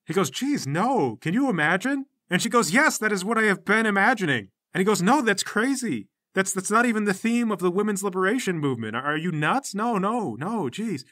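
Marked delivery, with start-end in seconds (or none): 0.3-2.0 high, incredulous protest; appalled disbelief. Acted. Leader rejecting Sasha's gory suggestion, appalled disbelief. 2.7-4.5 higher, louder insistence; emphatic retort. Acted. Sasha answering the leader's disbelief, emphatic retort. 5.0-11.0 high-pitched, flustered disbelief; appalled protest. Acted. Leader telling Sasha her idea is crazy, appalled protest.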